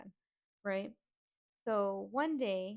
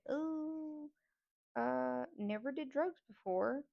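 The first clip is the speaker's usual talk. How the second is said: higher-pitched, drawn-out hesitation; hesitant; 6 semitones higher, slower than usual. Retold or imitated. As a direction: imitate an uneasy, reluctant reply. Reluctant reply to drug offer.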